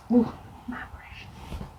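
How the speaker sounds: soft, hissed whisper of my Precious; Gollum whisper. Shown as a demonstration. Reading the eyeshadow name, she whispers like Gollum.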